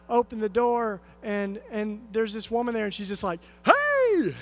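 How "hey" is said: excited greeting. Retold or imitated. Woman greets him at door.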